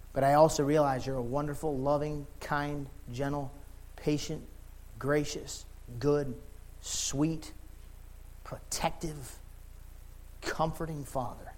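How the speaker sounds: soft, spaced-out words of reverence; tender prayer. Real, not acted. Preacher praising God as a loving Father, soft tender prayer. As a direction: let each word rest in a hushed pause.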